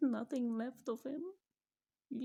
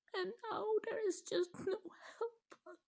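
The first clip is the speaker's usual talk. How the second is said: real tears spilling through the words; crying; 8 semitones higher, 3 dB quieter than usual. Real, not acted. Mother telling viewers about her son, crying. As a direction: laugh breaking into a sob, pitch up high.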